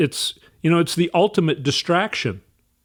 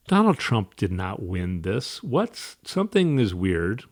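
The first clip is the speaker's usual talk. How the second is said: hushed disbelief; 7 semitones lower, 6 dB quieter than usual. Retold or imitated. Retold disbelief on waking after election.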